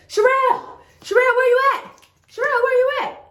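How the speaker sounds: high, loud calling her name across the street. Retold or imitated. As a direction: shout her name to find her. Homeboy calling out searching for her.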